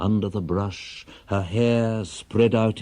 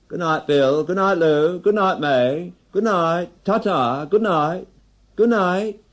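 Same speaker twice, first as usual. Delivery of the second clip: much higher, sing-song goodbyes; a higher, lilting voice trilling out the farewells; tipsy farewells; 11 semitones higher, 4 dB louder than usual. Acted. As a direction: call goodnight to each friend leaving the pub. Drinkers' farewells at closing.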